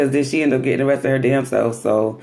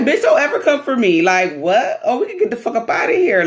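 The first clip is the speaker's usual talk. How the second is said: much higher, indignant and fast at the end; offended snap; 10 semitones higher than usual. Retold or imitated. Mimi clapping back at the girl who insulted her, indignant. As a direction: put her in her place.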